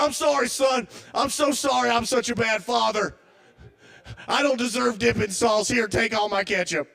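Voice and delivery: high, overwrought mock apology; dad apologizing. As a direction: ham up a guilt-ridden father begging forgiveness. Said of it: "And he's like".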